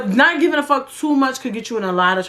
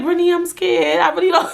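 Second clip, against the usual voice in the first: high-pitched imitation of a frightened friend; mock fear, voice pitched up; scared friend; 7 semitones higher than usual. Frightened friend confiding in Brittany on the train, imitated scared. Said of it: "she was mad scared".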